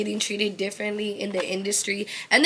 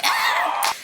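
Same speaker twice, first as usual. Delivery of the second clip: laughing squeal. Retold or imitated. Mock reaction with laugh.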